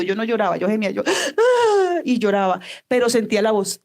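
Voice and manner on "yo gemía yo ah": higher, slow, anguished gasping groan; gasping moan. Retold or imitated. Preacher reliving her night of anguish, gasping wail. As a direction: re-enact the wail of her grief.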